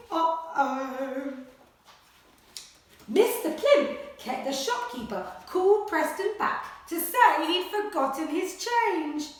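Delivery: animated picture-book read-aloud; reading to children. Acted. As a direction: read it like a bedtime adventure. Story reading continues.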